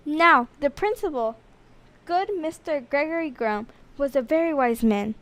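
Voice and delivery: ordinary delivery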